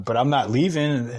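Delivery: ordinary talk, nothing marked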